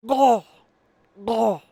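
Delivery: loud high barked "Oh!"; puffed-up pufferfish. Retold or imitated. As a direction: yelp like a pufferfish. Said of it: "It's the pufferfish noise".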